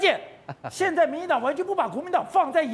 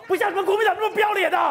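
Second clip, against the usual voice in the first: retold angry shout; 5 semitones higher, much faster than usual. Premier jeering at the opposition party, in a fast angry shout. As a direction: fling the insult across the chamber. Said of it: "他第二个是用国语，中文那个华语讲说".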